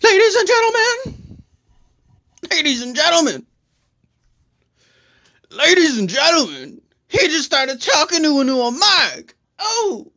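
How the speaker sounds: high, showy announcer call; hammed-up announcer. Announcer starts talking into a new mic, hammed-up and high. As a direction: crow it out high like a mock ringmaster.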